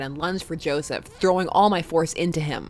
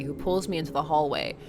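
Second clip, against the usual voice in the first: usual speaking voice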